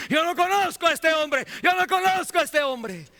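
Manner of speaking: Peter's desperate denial, shouted very high and loud; frantic yelled "no conozco a este hombre"; blasphemous denial. Retold or imitated. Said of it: "con blasfemias dijo que".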